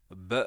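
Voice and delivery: B as an isolated "buh"; sounded-out consonant. Shown as a demonstration. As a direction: say B as a sound for the learner, not its name. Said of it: "si je fais un récapitulatif".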